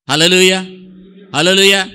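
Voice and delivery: loud high call of praise; shouted hallelujah. Real, not acted. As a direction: call it out to the church. Preacher calls out to congregation. (బిగ్గరగా హల్లెలూయా)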